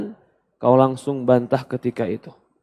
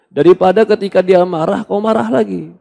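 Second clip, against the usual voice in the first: ordinary delivery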